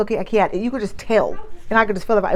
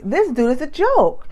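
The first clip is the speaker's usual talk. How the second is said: higher, drawn-out scoffing reaction; mocking disbelief; 5 semitones higher than usual. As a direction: stretch it out in amused scorn. Acting out her scornful reaction.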